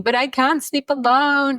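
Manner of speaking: high, whiny imitation of a child about to cry; pleading like a little girl who won't sleep alone, lightly played; crying child, retold. A mother mimics her crying daughter at bedtime, high and whiny. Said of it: "my daughter crying".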